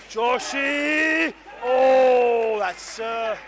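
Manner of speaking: loud, high cry at live play; real excited outburst; startled commentator's shout. Real, not acted. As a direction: yell the name, then a big drawn-out oh. Commentator shouts at the ball's outcome.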